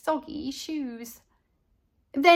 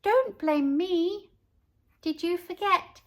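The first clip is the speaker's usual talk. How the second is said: sweetly; 5 semitones higher, 3 dB quieter than usual. A narrator's reading. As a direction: plead innocence sweetly. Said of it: "I sweetly answered".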